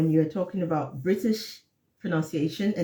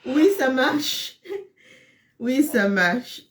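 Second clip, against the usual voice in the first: laughing; 6 semitones higher, 5 dB louder, slower than usual. Real, not acted. Laughing after a joke.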